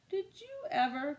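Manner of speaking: high, startled exclamation; gasping grandma. A narrator's reading. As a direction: gasp in disbelief at the duck. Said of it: "Grandma gasped".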